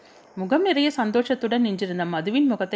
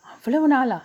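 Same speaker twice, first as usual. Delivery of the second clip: higher, astonished question; wide-eyed surprise; 6 semitones higher, 4 dB louder than usual. A narrator's reading. Girl hears the wedding is months away, surprised. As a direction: pitch jumps up in disbelief. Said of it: "கண்களை விரித்து காட்டி கேட்டாள்".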